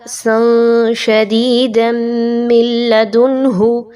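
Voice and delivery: सन शदीदम slow with nasal-hidden noon. Shown as a demonstration. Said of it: "इख्फा किया जाएगा". (सन शदीदम धीमे, इख्फा के साथ)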